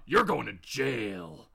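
low, slightly stern hero voice; lightly voiced deep hero. Hero turns to Bob to condemn him, stern hero. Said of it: "And he turns to Bob".

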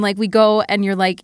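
ordinary delivery

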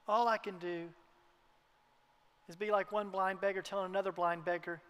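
usual speaking voice

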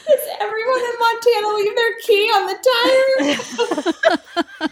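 very high, fast squeal through laughter; retold question, helpless with laughter; laughing incredulity. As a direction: wail it in mock disbelief as laughter takes over.

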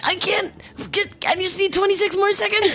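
put-on high whiny child voice; kid voice. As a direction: voice a toddler begging for time. Child voiced doing plank.

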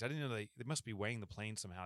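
normal talking voice

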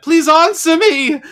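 very high, loud, slow wail of a plea; retold desperate scream. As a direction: plead at the top of your voice. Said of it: "she, she screams upstairs".

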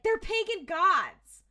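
loud, high, drawn-out outburst; mock exasperated yell. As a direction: imitate someone stating the obvious, loudly. Imitating Bobby's exasperated explanation.

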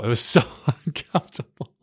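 laughing through the last words; uncomfortable laughter. Real, not acted. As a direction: break into a laugh midway. Laughing at awkward memory.

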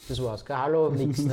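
half-laughing disbelief at the rudeness; amused indignation; indignant outburst. Real, not acted. Indignant about blunt doctor.

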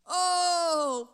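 normal talking voice

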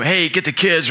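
mild imitation of a wife's yell; mock yell. Husband imitating his wife calling from another room, mock yell. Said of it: "she can yell at me".

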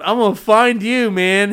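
angry shout. Retold or imitated. Tattooer shouting at the drunk outside his shop, angry. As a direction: yell it out at him.

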